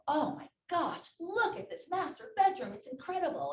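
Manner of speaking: high, loud gushing over a room; thrilled, loud re-enacted gush; delighted squeal. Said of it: "I'm squealing".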